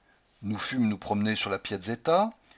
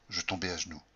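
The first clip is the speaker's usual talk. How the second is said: ordinary delivery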